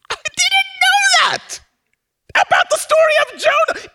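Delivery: preacher shouting his amazement at the congregation; astonished shout. Real, not acted. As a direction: burst out in real wonder.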